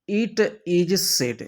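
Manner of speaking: slow, drawn-out formula; slow emphasis. Shown as a demonstration. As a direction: drag out it is said. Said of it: "stresses formula". (धीरे-धीरे ज़ोर देकर)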